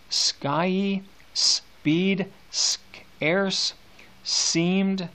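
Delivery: the s in Skyey speed scarce seemed drawn out and slow; hissing s sounds. Shown as a demonstration. Teacher showing the students the sibilants, slow and drawn out. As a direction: lean into every s of scarce seemed. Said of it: "the S sound in scarce".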